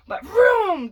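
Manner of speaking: loud playful vroom noise; mock vroom. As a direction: make the car zoom past. Imitating car speeding by.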